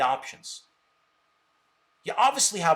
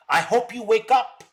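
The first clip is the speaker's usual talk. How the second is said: raised, louder plea; pushed hard, with the tune climbing on up; emphatic; 5 semitones higher, 6 dB louder than usual. Real, not acted. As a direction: hammer it home to him, higher and louder. Lecturing a critic on stream.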